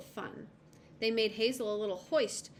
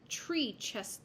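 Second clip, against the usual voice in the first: ordinary delivery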